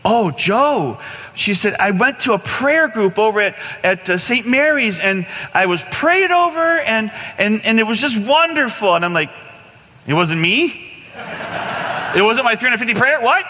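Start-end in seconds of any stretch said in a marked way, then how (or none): 0.0-9.0 high, glowing retelling of good news; bright and happy. Retold or imitated. Friend telling him about prayer group, high happy gushing. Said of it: "she was bright and happy". 10.1-13.4 rising, incredulous questions; mock-wounded, voice climbing in disbelief; disappointed disbelief. Man learning his prayers got no credit, disappointed disbelief. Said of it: "You know, kinda disappointed".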